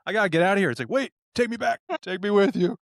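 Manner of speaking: high, panicked pleading; panicked demon. Retold or imitated. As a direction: beg to be taken back. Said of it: "He goes".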